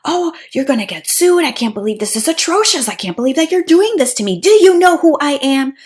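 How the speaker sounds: high, loud, indignant mock tirade; entitled outrage.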